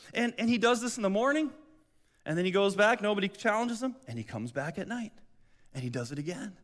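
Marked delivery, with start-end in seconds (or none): none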